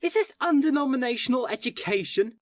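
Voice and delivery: mocking question. Syme mocks the policeman.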